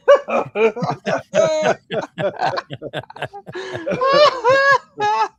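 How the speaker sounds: real long laugh, high and loud; long high laughter. Real, not acted. Host cracking up at Pardon my back, long high laugh. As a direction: let the laugh roll on.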